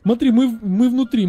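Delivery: normal talking voice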